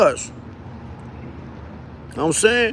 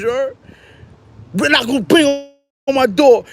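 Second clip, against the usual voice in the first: usual speaking voice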